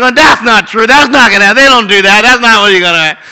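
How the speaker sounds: loud protest at the screen; loud indignant objecting. Retold or imitated. Dad ruining the movie for his daughter, loud indignant protest. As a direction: object forcefully, line after line.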